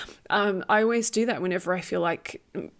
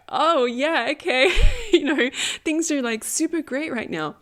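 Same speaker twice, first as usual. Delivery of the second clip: sarcastic, laughing, much higher and louder; giggly mock-breezy tone, pitched way up; mock cheerful; 8 semitones higher, 6 dB louder than usual. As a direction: play it as an ironic everything's-fine voice. Ironic quote of carefree history.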